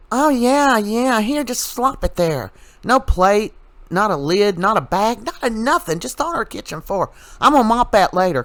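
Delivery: high, sing-song mock of a careless housewife; mocking the YouTuber by imitating her in a high voice; mocking Amanda. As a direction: sneer at her in a high airy voice. Mocking Amanda slopping dog food.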